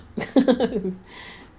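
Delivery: squealing giggle; real high laugh; high delighted laugh. Real, not acted. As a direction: burst out laughing, charmed. Laughing at the couple.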